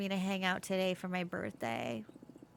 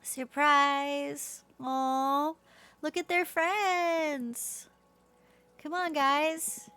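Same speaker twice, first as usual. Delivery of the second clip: higher, louder and drawn out; excited gushing; 6 semitones higher, 5 dB louder, much slower than usual. Real, not acted. Streamer gushes over a surprise party in the game. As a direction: gush over the cute friends. Reacting to birthday surprise scene.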